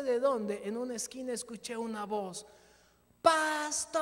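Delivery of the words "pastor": loud call from a dark street corner; loud shout of pastor from the shadows; distant call. Retold or imitated. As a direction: call out to him from far off. Voice calling from the dark.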